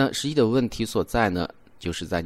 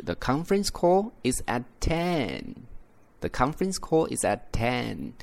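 ten rising then falling at the end; flat sentence, rise-fall on ten; teacher repeats the natural statement; statement intonation; 3 semitones higher than usual. Shown as a demonstration. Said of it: "地道的说法".

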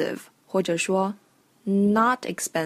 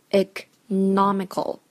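"Economical" split slowly, ec, then a stressed NOM-ical; slower than usual. Shown as a demonstration. Teacher showing the stress, "Economical" slowly broken before stressed no. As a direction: lean on the no in "Economical". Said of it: "重音在哪儿呢".

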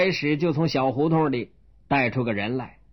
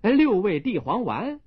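higher, brisk eager answer; higher chirpy doctor answer; quick doctor reply; 6 semitones higher than usual. Acted. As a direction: snap back the rhyme. Doctor fires back answer.

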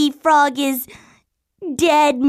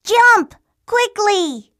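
urgent warning shout; 5 semitones higher than usual. Acted. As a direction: yell to save your little brother. Warning brother before the hoof.

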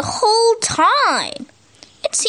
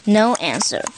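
ordinary delivery

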